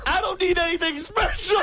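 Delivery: mock-offended shout; indignant yell. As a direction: yell it like you're insulted. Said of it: "So Beetle goes".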